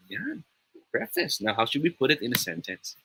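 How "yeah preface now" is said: usual speaking voice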